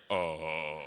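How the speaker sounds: very low, dreading "oh"; uneasy group reaction. Retold or imitated. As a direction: let out a low sound of dread.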